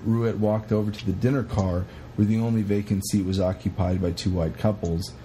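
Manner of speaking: ordinary talk, nothing marked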